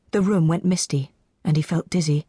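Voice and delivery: usual speaking voice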